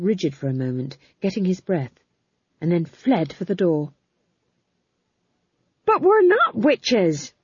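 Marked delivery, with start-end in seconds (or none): 5.8-7.3 high, loud, drawn-out indignant plea; high protest. Acted. Witch denying to the guards that they are witches, high protest. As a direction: protest it loudly, voice pitched right up.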